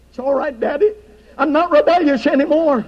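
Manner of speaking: a hint of a small boy answering his daddy; child voice. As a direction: lightly play the spanked son, chastened. Child quoted in anecdote.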